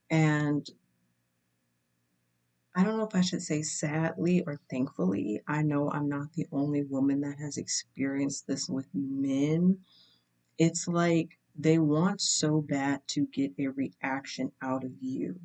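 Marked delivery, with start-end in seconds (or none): none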